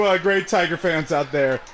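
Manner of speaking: usual speaking voice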